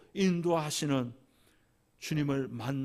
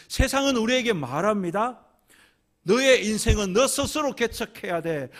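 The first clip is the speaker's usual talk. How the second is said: higher, louder sermon cry building to a climax; fervent preaching; 8 semitones higher, 8 dB louder than usual. Acted. Preacher proclaiming God's promise to the congregation, fervent and loud. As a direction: preach it out with rising fervor.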